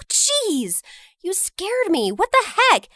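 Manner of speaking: high, jumpy fright; startled. Acted. Narrator startled by Jenny at the pool, jumpy. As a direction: caught off guard, rising to a yelp.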